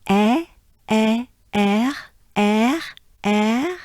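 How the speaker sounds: "eh" opening into "er" with a French r, rising; French "air" sound. Shown as a demonstration. Teacher building the next sound for learners, French èr. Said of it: "I will say".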